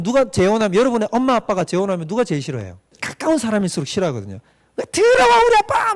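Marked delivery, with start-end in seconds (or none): 4.8-5.9 put-on high, loud child's cry of protest; a child shouting at a stepparent coming in; upset child protesting. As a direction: play the kid yelling at the new parent. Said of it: "막 이러면서".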